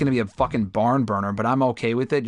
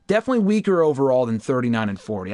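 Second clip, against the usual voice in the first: ordinary talk, nothing marked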